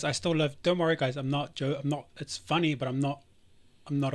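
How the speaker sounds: usual speaking voice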